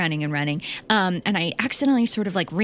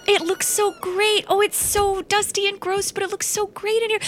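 high gushing delight; 10 semitones higher than usual. Acted. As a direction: swoon over the place. Gushing over dusty room.

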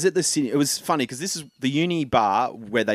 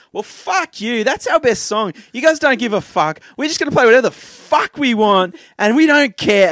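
loud, higher, furious singer's rant; mock-furious outburst at an ignoring bar crowd; angry rant; 11 semitones higher, 10 dB louder than usual. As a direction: tell the bored crowd off from the stage. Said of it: "He goes".

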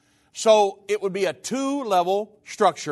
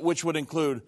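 ordinary talk, nothing marked

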